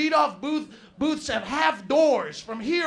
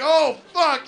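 panicked curse; 5 semitones higher, 7 dB louder than usual. Retold or imitated. Acid blur while driving.

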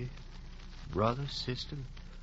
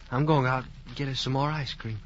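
normal talking voice

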